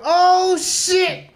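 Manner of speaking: sharp high yelp of sudden pain. Retold or imitated. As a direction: yelp as the leg gives out. Sudden leg pain in sparring.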